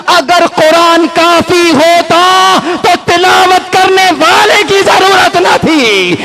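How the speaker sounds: high, slow, forceful sermon climax; impassioned preaching. Real, not acted. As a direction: build to a ringing call for blessings.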